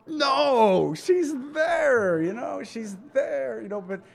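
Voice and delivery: high, half-laughing protest; preacher still laughing, taking back the joke. Real, not acted. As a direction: talk through the laughter. Laughing after his joke.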